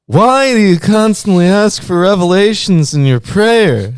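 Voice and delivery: high, loud, put-on young man; young man character. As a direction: scold in an exaggerated high character voice. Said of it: "a young man appeared to me".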